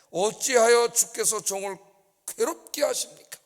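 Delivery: slow, high, loud pleading cry; Moses' anguished plea. Acted. A preacher voicing Moses crying out to God in anguish. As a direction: lament to God as a worn-out leader. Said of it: "모세가 여호와께 여짜오되".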